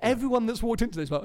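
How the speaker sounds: ordinary delivery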